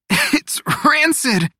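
high, strained, slow choke; coughed out. A narrator's reading. Teo choking on mineral water, coughing out disgust. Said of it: "he coughed".

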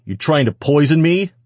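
loud, high-pitched accusation; angry snap. A narrator's reading. Man accusing the woman over his dinner, angry snap. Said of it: "he snapped".

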